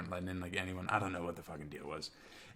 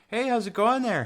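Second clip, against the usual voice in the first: high, loud Canadian border guard; hammed-up cheery Canadian accent; 11 dB louder than usual. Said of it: "have to have the right voice".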